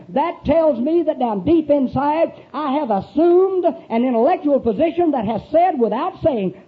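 ordinary talk, nothing marked

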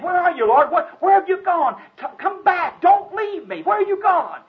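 high, loud frantic calling. Acted. Blinded Gideon calling for the Lord, high and loud. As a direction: shout for the lost Lord.

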